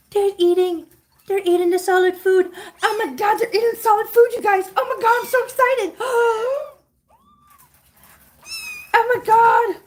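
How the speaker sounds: very high, tearful squealing joy; thrilled squeals. Real, not acted. To the viewers as the kittens eat solid food, real tearful excited squealing. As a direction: squeal with delight, almost crying.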